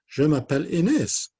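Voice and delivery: high, loud puppet introduction; high-pitched character voice; Inès puppet voice. Acted. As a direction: pipe up as a little puppet. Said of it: "Matisse and Inez".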